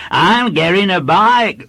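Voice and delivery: high, loud schoolboy voice; boasting small boy. A narrator's reading. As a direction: announce it proudly like a small boy. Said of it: "the boy told him".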